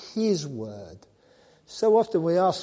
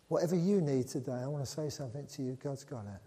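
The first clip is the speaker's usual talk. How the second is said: normal talking voice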